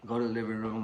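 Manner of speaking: usual speaking voice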